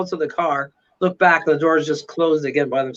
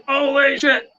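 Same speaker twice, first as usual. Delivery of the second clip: higher-pitched, mildly stunned exclamation; retold shock; 7 semitones higher than usual. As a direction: react to the slam, replaying the shock. Retelling shock at door slam.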